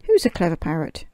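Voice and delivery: hammed-up parrot squawking its phrase. A narrator's reading. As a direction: rattle it off like a loud parrot. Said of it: "opened her beak and said".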